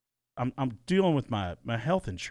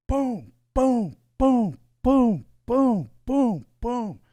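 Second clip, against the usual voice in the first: imitated banging; 9 semitones higher, 8 dB louder, much slower than usual. Imitating neighbor banging wall.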